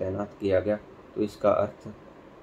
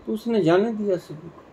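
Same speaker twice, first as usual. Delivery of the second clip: ordinary delivery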